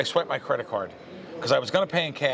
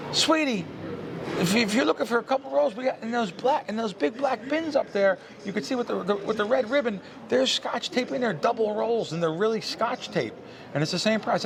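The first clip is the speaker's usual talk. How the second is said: high, sweet Southern-lady voice, much higher; 9 semitones higher than usual. Retold or imitated. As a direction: imitate a kind Southern shop lady calling you sweetie. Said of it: "you know, the Southerner".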